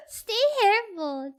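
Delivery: laughing through the words. Real, not acted. Streamer cracks up repeating chat's silly phrase, laughing. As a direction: barely get it out through giggles.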